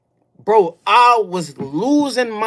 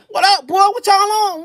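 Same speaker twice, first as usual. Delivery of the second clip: hyped party greeting; 9 semitones higher, 3 dB louder than usual. Retold or imitated. Acting his party greeting.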